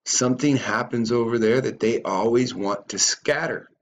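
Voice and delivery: normal talking voice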